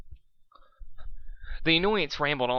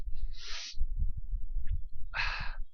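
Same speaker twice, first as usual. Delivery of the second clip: sigh. Real, not acted. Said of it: "reader settling".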